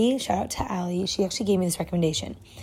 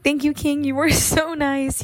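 high, louder gushing thanks; excited thanks; 8 semitones higher, 6 dB louder than usual. Retold or imitated. Student gushing thanks to professor for the extension, higher and louder. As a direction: gush with delight like you've been rescued. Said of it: "I'm like".

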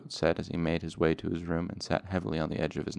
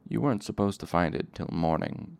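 plain reading voice

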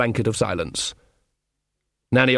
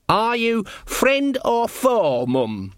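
slow, high formal sentry voice; guard's challenge; 10 semitones higher, much slower than usual. A narrator's reading. Sean formally challenges his own mother, high and stiff. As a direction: chant the challenge high, then drop on the last word.

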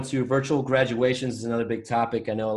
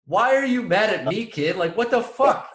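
put-on higher and louder, fed-up shouting at the kid; exasperated yell; 10 semitones higher, 6 dB louder than usual. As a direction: yell it at him in disbelief. Retelling angry reaction to driver.

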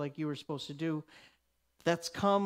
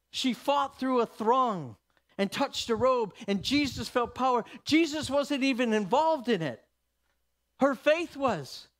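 louder and higher, hammering each word; building sermon cry, louder and higher; full-force preaching; 6 semitones higher, 5 dB louder than usual. Acted. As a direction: preach it up to a climax, pounding completely.

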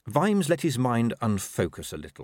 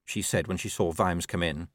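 ordinary delivery

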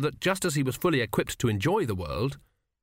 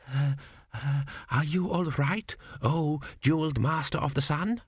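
timid; 4 semitones higher than usual. A narrator's reading. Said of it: "one of them ventured".

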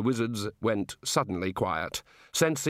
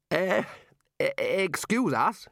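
high, halting politeness; embarrassed stammer; 8 semitones higher, slower than usual. Acted. Ridcully excusing the wizards from the god, awkwardly. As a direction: stall awkwardly.

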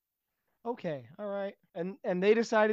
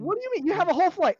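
incredulous; 7 semitones higher than usual. Acted. Instructor incredulous at clueless cadet.

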